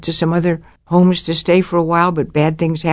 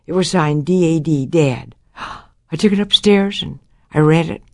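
ordinary delivery

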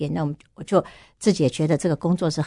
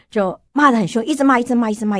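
ordinary talk, nothing marked

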